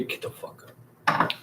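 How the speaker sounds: hushed breathy whisper, voice gone; a sudden secret whisper to wake him; whispered urging. Pretend. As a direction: whisper it into his ear so only he hears. Whispered aside to sleeping Al.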